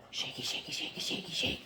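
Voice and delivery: soft breathy sing-song shaky shake; whispered dog cue. Real, not acted.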